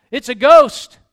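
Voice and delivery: a loud frightened yell, hammed up as the disciples; fearful cry. The disciples in the boat crying out in fear, loud scared yell. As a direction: throw out a scared shout of ghost.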